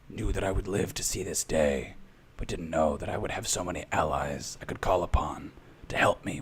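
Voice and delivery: low, grave, measured voice with long pauses; solemn patient monolith. Acted. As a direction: address the gathered crowd as an ancient stone elder, gravely. Game master voices the patient monolith.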